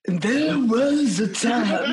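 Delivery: very slow, laughing stretched line; DJ drawl. Pretend. Redoing the old DJ bit, slow and laughing. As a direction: drag each word out slowly. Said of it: "Swedish House Mafia".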